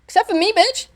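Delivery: high, cheeky crow; sassy, high-pitched comeback; cheeky retort. Real, not acted. Gleeful retort to the game's warning.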